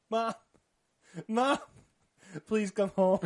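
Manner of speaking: put-on high, loud pleading child; mock hysterical crying. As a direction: imitate a distraught child calling for mom. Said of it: "hysterically crying, saying".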